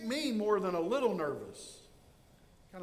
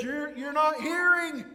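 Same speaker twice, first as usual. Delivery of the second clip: loud, higher protest at God; accusing shout; 7 semitones higher, 6 dB louder than usual. Acted. Habakkuk shouting that God isn't hearing. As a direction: hurl the complaint upward. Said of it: "When he says".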